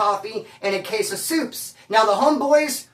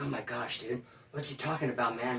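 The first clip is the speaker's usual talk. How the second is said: much softer, lower stunned murmur; stunned hush; 8 semitones lower, 13 dB quieter than usual. Retold or imitated. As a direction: mutter it in disbelief. Anxious reaction to money order.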